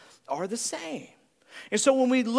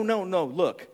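ordinary delivery

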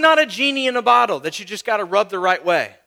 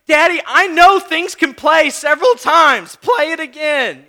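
insistent little daughter; 4 semitones higher, 6 dB louder than usual. Retold or imitated.

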